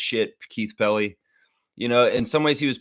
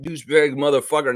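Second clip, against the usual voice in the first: usual speaking voice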